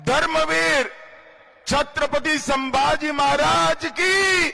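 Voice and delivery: high, shouted slogan; roaring rallying speech. Real, not acted. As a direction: hurl the slogan to the crowd. Chant led for crowd.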